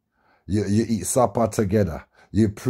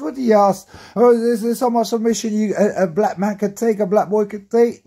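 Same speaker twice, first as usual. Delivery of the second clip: high indignant protest; 11 semitones higher, 4 dB louder than usual. Retold or imitated. Retelling his own protest.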